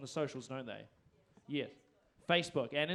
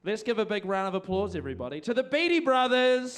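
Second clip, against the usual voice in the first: MC's stage introduction; 6 semitones higher, 8 dB louder than usual. Real, not acted. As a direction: whip up applause, ending on a big shouted band name. MC calls the band on stage.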